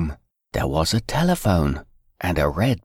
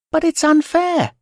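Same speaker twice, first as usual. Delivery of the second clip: put-on high upset girl's voice; crying little girl; 3 dB louder than usual. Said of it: "cried Fern".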